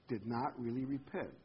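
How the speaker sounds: ordinary talk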